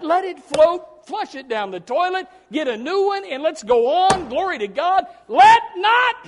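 loud, high, impassioned preaching; fiery preaching. Acted. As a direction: preach it loud to lift the congregation. Preaching at full force.

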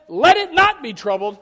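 preaching shout. Real, not acted. Preacher proclaiming God's command to the congregation, shouting. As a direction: proclaim it with full force.

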